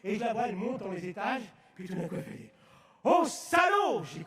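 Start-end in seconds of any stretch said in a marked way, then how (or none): 3.0-4.0 cri outré imité. Retold or imitated. Collègue outrée lisant le verset.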